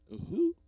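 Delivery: high loud whoop; excited whoop. Real, not acted. Whooping at own joke, loud and high. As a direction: let out a loud giddy whoop.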